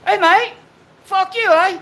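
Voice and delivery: high, loud, hammed-up Australian voice; over-the-top Australian accent, cheeky jab; mock Australian accent. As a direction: yell it like a cartoon Aussie. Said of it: "I was gonna be Adam".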